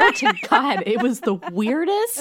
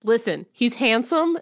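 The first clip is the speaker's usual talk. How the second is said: ordinary delivery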